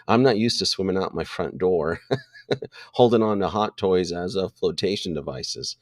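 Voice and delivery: ordinary delivery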